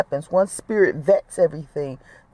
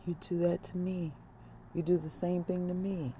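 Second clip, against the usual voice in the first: much softer, calm and gentle; quiet voice of Spirit; 15 dB quieter than usual. Retold or imitated. Spirit answering the worried mother, very soft and calm. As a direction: hush it down like a calm inner voice. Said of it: "Spirit said to me so quietly".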